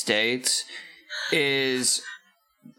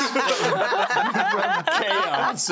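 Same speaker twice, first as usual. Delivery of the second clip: much higher, laughter breaking through; laughing; 11 semitones higher, much slower than usual. Real, not acted. Laughing mid-sentence.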